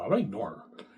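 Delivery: ordinary talk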